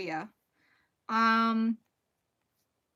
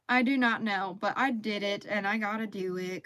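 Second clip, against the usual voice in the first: ordinary delivery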